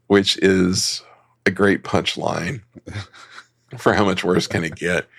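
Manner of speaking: normal talking voice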